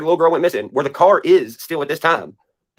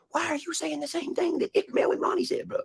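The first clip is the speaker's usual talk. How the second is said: much higher, mocking falsetto; mock quote; 8 dB quieter than usual. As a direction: squeak it out as a mock of himself. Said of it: "I'm like".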